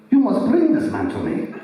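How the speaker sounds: exaggerated high lady professor voice; hammed-up, high-pitched command; comic put-on voice of an insistent lady professor; Dr. Ruth-like professor. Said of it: "She doesn't talk like that".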